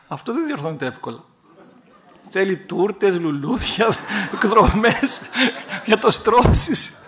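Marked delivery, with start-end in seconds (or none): 2.3-7.0 laughing. Real, not acted. Joking about making up with cakes.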